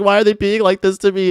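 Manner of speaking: normal talking voice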